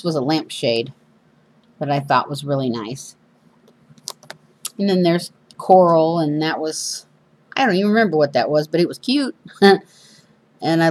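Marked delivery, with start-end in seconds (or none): none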